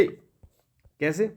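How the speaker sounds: normal talking voice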